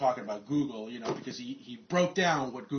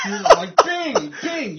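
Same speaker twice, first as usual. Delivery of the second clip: much higher mock chant, crying underneath; forced cheer; 14 semitones higher, 12 dB louder, much slower than usual. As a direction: fake chirpy bing.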